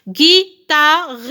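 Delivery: "Guitare" in three loud, separate beats; syllable by syllable. Shown as a demonstration. Teacher counting syllables for pupils, loud separate beats. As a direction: split "Guitare", sounding the final e. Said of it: "il y a trois syllabes".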